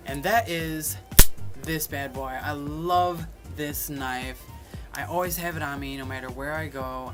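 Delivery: ordinary delivery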